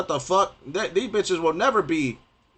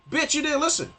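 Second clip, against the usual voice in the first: scornful comeback pitched much higher; mock retort; 9 semitones higher, 3 dB quieter than usual. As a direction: snap it back, dripping with contempt. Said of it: "I'm like".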